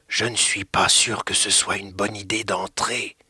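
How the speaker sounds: hiding behind her, unsure; timid and scared. Acted. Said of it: "dit Théo caché derrière Emma". (voix timide et plus douce, apeurée)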